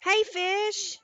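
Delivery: bright high greeting to a viewer; calling out. Real, not acted. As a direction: sing out the name in welcome.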